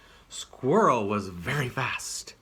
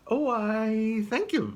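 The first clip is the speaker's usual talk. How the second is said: high squirrel voice; a small squirrel, flattered; 12 semitones higher, 4 dB louder than usual. Acted.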